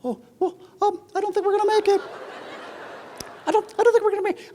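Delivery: very high, fast, panicky squeak; student squeaking that they won't make it; up another octave. Retold or imitated. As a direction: jump way up in pitch, rushing the words. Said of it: "his voice starts to go up another octave".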